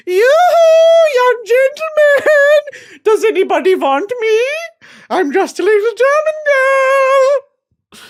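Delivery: mock German girl. Calling into the barracks for young men, high hammed-up girly call. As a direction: coo to the barracks like a lost damsel.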